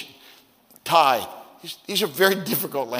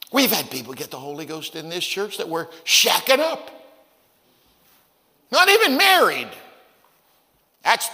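scandalized outrage; 4 semitones higher than usual. Retold or imitated. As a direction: imitate an indignant churchgoer aghast at sin. Acting out scandalized church critics.

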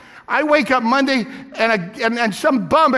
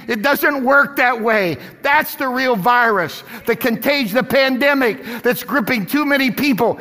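ordinary talk

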